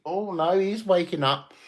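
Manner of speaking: ordinary delivery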